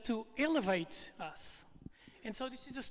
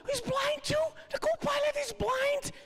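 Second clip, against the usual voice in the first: panicked passengers. Retold or imitated. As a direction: shriek it like terrified passengers. Passengers see blind co-pilot.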